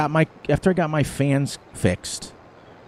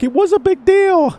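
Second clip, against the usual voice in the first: much higher, louder, whiny protest; mock indignant whine; 7 dB louder than usual. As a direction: squeal it like a wounded ego. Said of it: "And I'm like".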